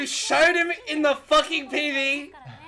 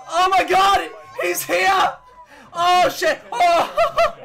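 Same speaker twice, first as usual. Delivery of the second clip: loud, high, laughing shouts; real overwhelmed joy, yelled; ecstatic yelling; 5 semitones higher, 6 dB louder, slower than usual. Real, not acted. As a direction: holler it through laughter. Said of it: "I'm yelling so much".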